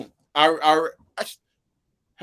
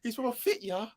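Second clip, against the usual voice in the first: higher, softer mock British accent; mimicked British accent; 5 semitones higher, 9 dB quieter than usual. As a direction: parrot a bad British accent back teasingly. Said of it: "That's what he says".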